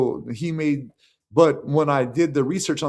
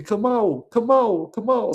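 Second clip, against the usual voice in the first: high, louder mimicked name-calling; imitated girls calling; 9 semitones higher, 5 dB louder than usual. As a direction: call out the name like the pool hall girls. Girls calling out his nickname.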